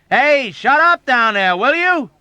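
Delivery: high, loud angry holler; shouting. Acted. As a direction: yell across the ward. Patients hollering at Dobbs.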